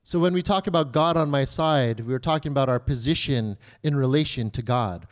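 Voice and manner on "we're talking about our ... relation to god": usual speaking voice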